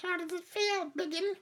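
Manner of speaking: high, sneering little-monster voice; gleeful goblin taunt. Acted. Enemy goblin taunts the hero it is knifing, high sneer. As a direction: jeer at the tied-up hero in a tiny high screech.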